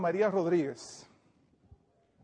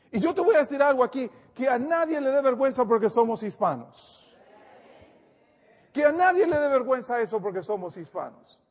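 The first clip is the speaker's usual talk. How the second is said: rising, louder sermon push; fervent preaching; 6 semitones higher, 5 dB louder than usual. Acted. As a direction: preach it with conviction to the flock. Pastor preaching to congregation.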